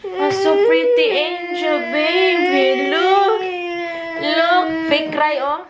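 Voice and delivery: high, slow sing-song cooing. Real, not acted. Baby talk to toddler.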